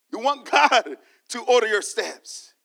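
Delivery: ordinary delivery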